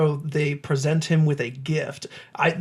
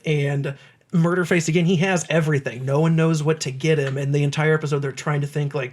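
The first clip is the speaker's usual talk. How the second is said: normal talking voice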